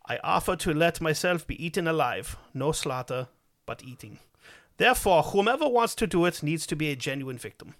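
put-on reading the message aloud in a German accent; German-accented reading of a chat message. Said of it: "I'm semi-fluent in a German accent".

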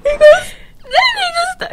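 high-pitched, cracking-up laughing voice; can barely talk for laughing; helpless laughter. Real, not acted. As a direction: squeal it out through real laughter. Cracking up telling the story.

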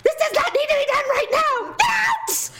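high-pitched screamed outburst; re-enacted yell at the top of the voice; furious shout. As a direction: explode at someone underfoot while cooking. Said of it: "I'm getting furious".

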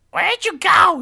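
very loud, high-pitched holler; witch's twin calling. Acted. Twin inside house calling after witch, high shout. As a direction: yell it from another room. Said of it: "it's the exact same voice of the woman".

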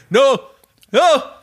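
a captive's mock wail rising into a shout; hammed-up pleading. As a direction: beg in hammed-up terror, climbing up. Mock prisoner pleading against torture.

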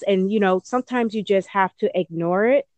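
usual speaking voice

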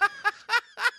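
high burst of a real laugh; sudden high-pitched laughter. Real, not acted. Laughing at Austin Powers gag.

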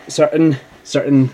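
prim priest. Acted. Priest composes himself.

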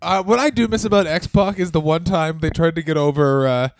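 normal talking voice

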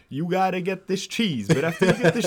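imitating Bert. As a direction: ham up the kid bossing you round the aisles. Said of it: "he's like".

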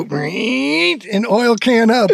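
high drawn-out "Reet"; Baba's "Reet". Shown as a demonstration. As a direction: say it like Baba. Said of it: "if you just go".

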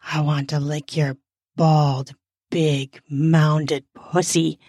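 low voice with deliberate breaks; voiced male character. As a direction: drop low and pause between the words. Narrator voices the man's line.

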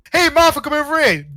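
high, loud call across a street; shouting to a mate. Acted. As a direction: call a friend over from afar. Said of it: "somebody's shouting to their mate".

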